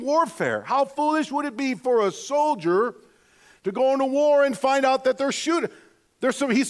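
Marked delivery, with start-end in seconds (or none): none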